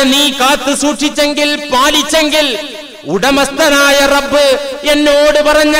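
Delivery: high, loud, drawn-out acted lament; weeping repentance. Robber chief repenting before the truthful boy, acted tearful outcry. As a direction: cry out in remorse in a sustained preacher's wail. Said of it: "കൊള്ളത്തലവൻ പൊട്ടിക്കരഞ്ഞു".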